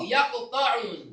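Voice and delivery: Ya ko ta'un slowly, an o vowel inserted after the q; common wrong reading. Shown as a demonstration. Teacher imitating how students often read it wrong. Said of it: "kerap kita baca atau kita dengar".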